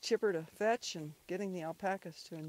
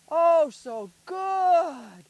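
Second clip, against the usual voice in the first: loud, high, very drawn-out praise; gushing praise; 8 semitones higher, 14 dB louder, much slower than usual. Real, not acted. Owner praising dog on the way back, gushing praise. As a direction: stretch every word in delight at the dog.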